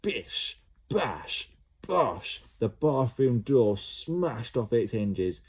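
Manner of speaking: usual speaking voice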